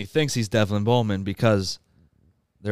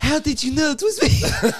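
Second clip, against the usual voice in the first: very high, loud quote through laughter; laughing mock outcry; 5 dB louder than usual. Imitating Pistachio found out, high and laughing. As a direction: cry it out as the exposed impostor while laughing.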